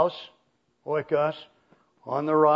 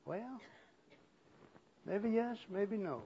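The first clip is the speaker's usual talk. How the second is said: soft, higher, noncommittal waver; hesitant congregant; 4 semitones higher, 15 dB quieter than usual. Retold or imitated. Preacher mimicking unsure listeners in the congregation, hesitant. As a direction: imitate a listener hedging quietly. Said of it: "some of you are going".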